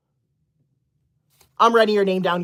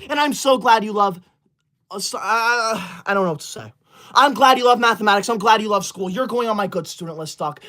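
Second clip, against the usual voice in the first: ordinary talk, nothing marked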